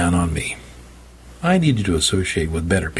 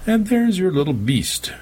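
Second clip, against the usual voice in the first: high sneer; 11 semitones higher than usual. Acted. Prophet sneers at cat.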